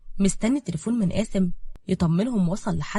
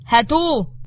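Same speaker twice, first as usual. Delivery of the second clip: loud, higher command with falling end; sharp raised-voice command; barked order; 7 semitones higher, 7 dB louder, slower than usual. A narrator's reading. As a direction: order the soldiers to seize him. Officer orders soldiers to seize him.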